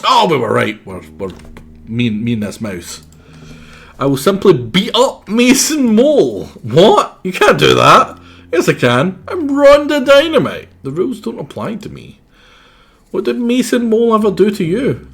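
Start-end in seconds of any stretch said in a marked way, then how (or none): 4.0-15.1 much higher, louder brash cartoon voice; high character voice. Rhonda Dynamite vowing to beat up Mason Mole, high and brash. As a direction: boast it like a tiny tough outlaw. Voicing game character dialogue.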